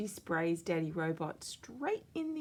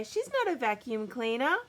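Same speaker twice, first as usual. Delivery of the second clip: put-on louder, higher indignant kid; higher, louder kid joining in the protest; child yelling; 7 semitones higher, 5 dB louder than usual. A narrator's reading. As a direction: back your sister up loudly. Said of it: "yells Bingo".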